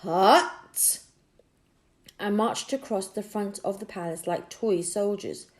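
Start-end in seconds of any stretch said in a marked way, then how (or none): 0.0-1.0 sharp, loud soldier's bark; short military shout of hut; guard's barked command. Acted. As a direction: snap it out like a drill order. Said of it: "The palace guards both suddenly said".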